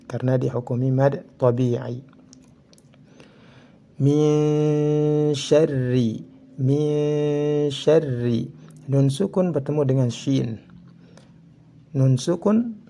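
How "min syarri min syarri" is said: very slow "Min syarri", n blurred into a long nasal hum; chanted "Min syarri" with a held, hidden n; veiled nun. Shown as a demonstration. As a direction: hum through the n of "Min syarri" without closing it. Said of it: "harus dibaca dengan samar".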